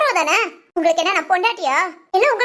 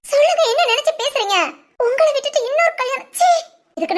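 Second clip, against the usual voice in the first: higher, pressing and indignant; upset demand; 6 semitones higher than usual. Acted. Wife confronting husband about remarriage, upset and pressing. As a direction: push him for the truth, stung.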